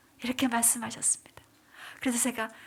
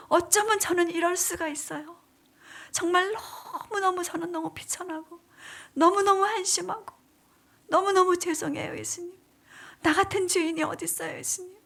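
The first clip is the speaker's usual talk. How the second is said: high, pleading, tearful confession; retold sobbing plea, higher pitched; weeping prayer; 5 semitones higher than usual. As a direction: cry out in remorse. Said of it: "제가 이러면서 울면서 기도하는데".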